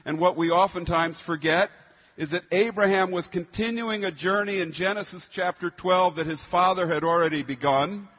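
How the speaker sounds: normal talking voice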